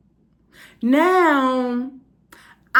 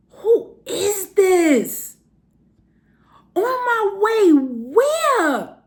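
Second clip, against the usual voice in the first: high, very drawn-out questions; retold incredulous demand; baffled outrage; 7 semitones higher, much slower than usual. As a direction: stretch each word in disbelief. Retold baffled phone reply.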